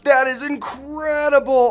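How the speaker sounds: high, slow awestruck whoa; amazed. Real, not acted. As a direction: marvel at the warping world. Amazed by warping game world.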